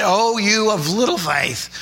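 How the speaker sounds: a slow, lightly voiced gentle rebuke. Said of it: "maybe he said it".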